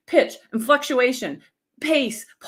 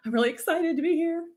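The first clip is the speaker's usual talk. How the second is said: higher, brighter excited take; mean it this time; genuine excitement; 6 semitones higher, faster than usual. Shown as a demonstration. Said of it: "it doesn't have to be over the top".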